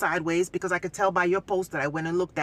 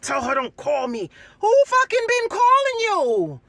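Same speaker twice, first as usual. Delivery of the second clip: high, loud, slowed furious yell; retold outburst; 11 semitones higher, 7 dB louder, slower than usual. Woman retelling her blow-up at her ex in mediation, shouting. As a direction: blow up at him, stretching each word.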